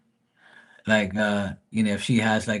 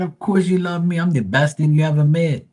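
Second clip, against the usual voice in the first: much higher, louder brag; cocky high boast; 7 semitones higher, 5 dB louder than usual. Retold or imitated. Teasing his mom that he is her favorite, a high cocky brag. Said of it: "I said".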